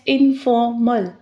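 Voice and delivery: "informal" slowly in three beats, in, for, mal; split into syllables. Shown as a demonstration. Teacher introducing the next word, "informal" slowly split in-for-mal. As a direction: separate "informal" into three distinct parts. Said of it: "three syllables".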